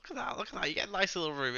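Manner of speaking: high, loud, delighted cooing. Acted. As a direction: gush over a cute pet. Cooing over a game character.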